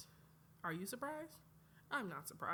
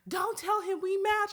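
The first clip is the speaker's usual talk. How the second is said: loud urgent plea; 11 semitones higher, 13 dB louder than usual. Retold or imitated. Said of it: "It's like".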